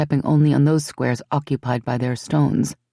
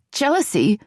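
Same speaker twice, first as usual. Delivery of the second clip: indignant echo; 8 semitones higher than usual. Acted. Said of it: "a hard thrusting rock of feminine outrage".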